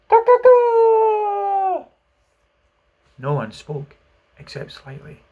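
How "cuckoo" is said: very high, loud drawn-out crow; long rooster crow. A narrator's reading. The unseen thing crows again from the cabin, long high crow. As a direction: hold a shrill crow till it falls. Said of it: "again a crow".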